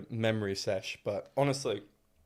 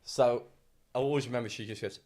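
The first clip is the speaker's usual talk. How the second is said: ordinary delivery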